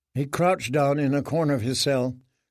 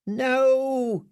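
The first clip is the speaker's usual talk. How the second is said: frightened mumble; 11 semitones higher than usual. Acted. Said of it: "he mumbled".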